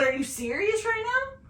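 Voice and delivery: high-pitched incredulous demand; mad disbelief. Retold or imitated. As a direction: challenge him, voice shooting up. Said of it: "I was so mad".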